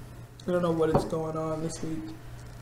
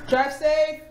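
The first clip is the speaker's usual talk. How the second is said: cheery farewell call; 11 semitones higher, 7 dB louder than usual. Real, not acted. Goodbye to coworker.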